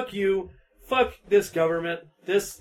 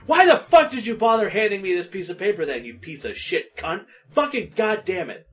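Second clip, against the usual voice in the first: angry rant; 5 semitones higher, 5 dB louder than usual. Real, not acted. Ranting about redacted papers.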